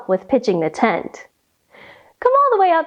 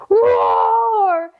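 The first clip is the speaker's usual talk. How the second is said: lion roar; 10 semitones higher than usual. Acted. Lion pounces on tent.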